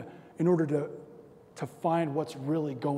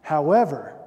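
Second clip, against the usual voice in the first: loud, punched HOWEVER; emphatic; 7 dB louder than usual. Real, not acted. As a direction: hit HOWEVER hard and loud. Word repeated, first emphatic.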